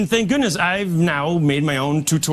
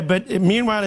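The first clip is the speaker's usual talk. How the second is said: ordinary talk, nothing marked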